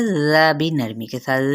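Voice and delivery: ordinary delivery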